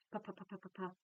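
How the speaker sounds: rapid-fire "puff, puff" drill; quick, light "puff" syllables fired off; fast repetition. Shown as a demonstration. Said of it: "but as fast as you can".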